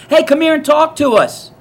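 loud high call across the street; hollered come-on. Retold or imitated. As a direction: call him over from the station bench. Girls calling him over.

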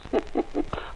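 short breathy chuckle, high; rueful laugh. Acted. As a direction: huff out a knowing laugh. Laugh at "much worse.

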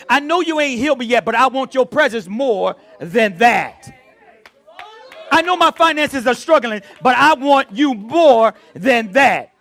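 high, loud cry of prayer; impassioned preaching. Acted. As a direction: drive each plea to a loud, drawn-out finish. Preaches prayer at full force.

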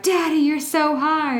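high, slow mock seductive read. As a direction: ham up a breathless romance heroine. Mock reading of smut quote.

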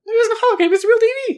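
high squeaky stubborn denial; squeaky insistence. Real, not acted. Arguing with Joanna over Deevee, squeaky protest.

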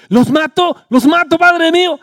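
high, loud, eager repeated offer; a king itching to strike; eager bloodthirst. Acted. King of Israel begs Eliseo to let him kill, high and loud.

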